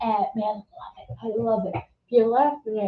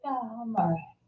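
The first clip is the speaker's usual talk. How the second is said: quiet flat reply; 8 dB quieter than usual. Retold or imitated. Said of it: "She's like".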